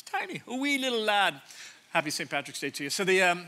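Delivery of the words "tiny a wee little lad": mock Scottish. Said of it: "I think that was Scottish".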